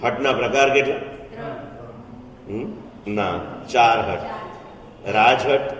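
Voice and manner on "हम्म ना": ordinary talk, nothing marked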